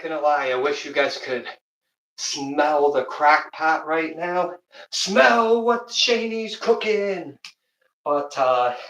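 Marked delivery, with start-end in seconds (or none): 4.9-7.4 wrestler catchphrase. Pretend. Catchphrase riff, wrestling announcer.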